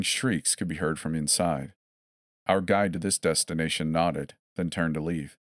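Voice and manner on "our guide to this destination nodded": plain reading voice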